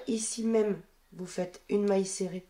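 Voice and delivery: normal talking voice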